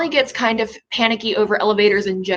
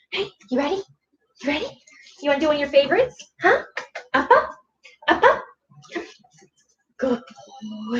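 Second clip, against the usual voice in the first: high, sing-song dog cues; talking to a dog; 4 semitones higher, faster than usual. Real, not acted. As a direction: coax the dog up brightly.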